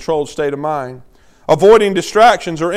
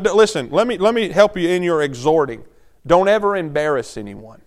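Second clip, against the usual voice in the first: usual speaking voice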